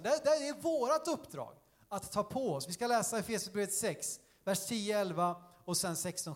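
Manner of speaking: ordinary talk, nothing marked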